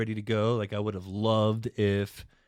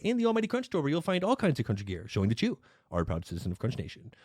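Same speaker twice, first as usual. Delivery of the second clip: ad read; 5 semitones higher, faster than usual. Acted. Podcast ad read.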